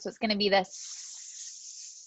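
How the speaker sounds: a long unvoiced S hiss; hissed S demo. Shown as a demonstration. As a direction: hiss the S out steadily. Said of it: "it's just like the S sound".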